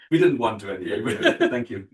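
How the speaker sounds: loud, laughing through the words. Real, not acted. Host joking back to the speaker, laughing.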